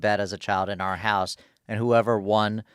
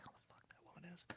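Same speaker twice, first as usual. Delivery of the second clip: whispered; 7 semitones higher, 34 dB quieter than usual. Retold or imitated. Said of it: "she's like".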